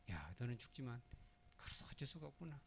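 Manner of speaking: a hushed, regretful farewell; sorrowful quiet. Retold or imitated. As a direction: speak sadly to the lamb you must kill. Abel speaks to the dying sheep.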